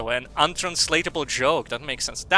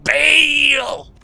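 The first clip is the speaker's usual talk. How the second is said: high, loud outraged scolding; indignant shouting; 8 semitones higher, 7 dB louder than usual. Acted. Voicing a game character scolding everyone, indignant shouting. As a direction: shout it out, climbing to a final yell.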